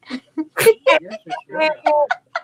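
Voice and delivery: real burst of laughter, high; laughing. Real, not acted. Guest laughs at the host's impression. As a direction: crack up at the bad accent.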